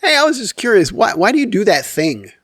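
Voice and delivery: high, light imitation of a wife asking; lightly voiced curious wife; wife, casually curious. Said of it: "she'll say".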